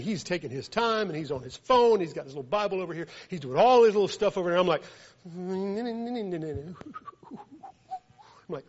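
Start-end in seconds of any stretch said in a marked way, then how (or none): 5.2-6.5 wordless sing-song mumbling while waiting; mock waiting noises; impatient nonsense humming. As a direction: hum idly, killing time.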